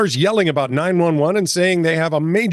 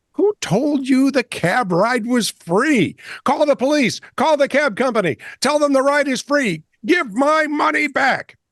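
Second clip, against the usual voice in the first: rising, higher angry demands; slower, building to a shout at the end; angry patient; 8 semitones higher than usual. Retold or imitated. Angry patient quote in story.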